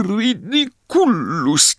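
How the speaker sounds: high, slow sobbing Ridiculus; sobbing spell. Acted. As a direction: sob the spell out. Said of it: "zaszlochała jeszcze głośniej".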